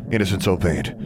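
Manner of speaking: plain reading voice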